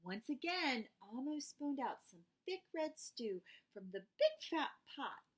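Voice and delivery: usual speaking voice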